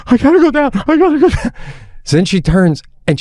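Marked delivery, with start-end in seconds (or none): 0.1-1.5 high-pitched, gasping mock panic; imitated child panic. Scared daughter pleads with dad high on the mountain, imitated panic. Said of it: "she starts to panic".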